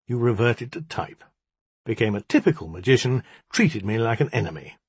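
usual speaking voice

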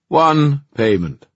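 slow, loud call; loud announcement. Acted. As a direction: proclaim it to all. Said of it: "said the puka loudly".

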